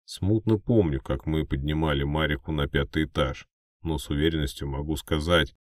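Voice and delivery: plain reading voice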